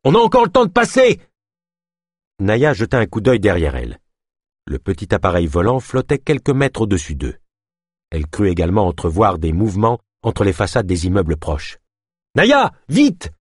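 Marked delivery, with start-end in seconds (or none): 0.0-1.2 very high, urgent shout; rallying cry at the top of the range; shouted command. A narrator's reading. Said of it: "cria Delune". 12.3-13.3 high shouted hurry-up; frantic, high-pitched yell; urgent calling. A narrator's reading. Urgent calling while fleeing.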